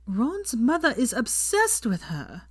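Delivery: usual speaking voice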